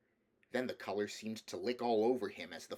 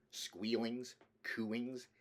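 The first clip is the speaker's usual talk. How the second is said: ordinary delivery, nothing marked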